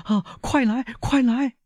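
very high, urgent cry; panicked high-pitched plea; frantic calling. A narrator's reading. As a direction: beg someone to come at once. Frantic call for help in emergency.

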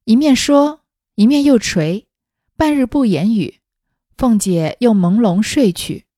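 measured, projected storybook reading; story narration. A narrator's reading. Narrator reading a classic novel to listeners, story narration. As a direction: read it out deliberately, acting the dialogue lightly.

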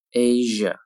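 "Asia" a little stretched, evenly said; slightly slow, careful "Asia"; drawn-out model. Shown as a demonstration. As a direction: lengthen "Asia" slightly for learners. Teacher models word slowly, then normally.

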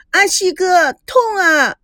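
high, pleading little-sister whine; a hurt girl clinging to her brother; whiny cry. A narrator's reading. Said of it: "大叫".

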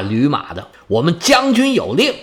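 usual speaking voice

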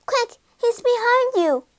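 high-pitched, urgent cry; urgent warning. A narrator's reading. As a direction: warn someone in danger, voice pitched high. Urgent warning during the chase.

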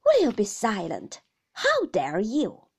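loud, indignant command; Mister Murdstone thundering. A narrator's reading. Brother rebuking his sister, loud and thundering. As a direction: thunder it with heavy outrage. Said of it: "thundered Mister Murdstone".

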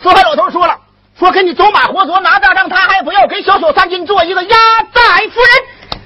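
high-pitched stage recital; high declamation, building to the last words; theatrical tattling. Acted. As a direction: relay the message in a high, sustained voice. Acted relaying of a provoking message.

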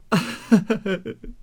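high-pitched sobbing cry; high stuttering wail. Acted. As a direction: burst into hammed-up, wordless sobs. Fleeing woman wails to matriarch. (呜呜地高声哭)

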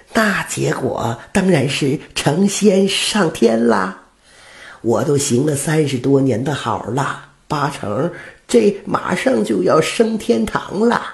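put-on old woman sure of her reward. Old woman boasts of heaven. (老太太得意地说，声音高)

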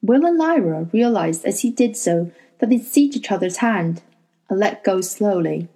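ordinary delivery

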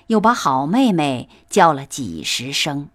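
ordinary delivery, nothing marked

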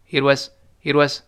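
"it was" run together as one quick blur; very fast reading. Shown as a demonstration. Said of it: "读得非常快的时候读成".

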